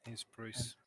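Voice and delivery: hushed aside; barely voiced whisper. Sneaking past praying priests.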